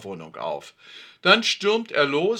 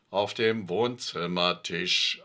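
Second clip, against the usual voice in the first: thoughtful; 7 semitones lower, slower than usual. A narrator's reading. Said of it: "antwortet Alfred nachdenklich".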